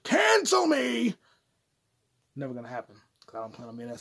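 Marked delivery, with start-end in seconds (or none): 0.0-1.2 shouting. Acted. Shouted line.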